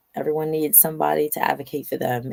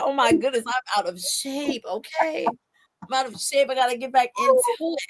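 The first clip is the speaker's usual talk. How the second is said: higher, put-on voice of comic dismay; mock dismay; 9 semitones higher than usual. Retelling her first jump, higher mock dismay. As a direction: ham up realizing you're out of shape. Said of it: "and I said".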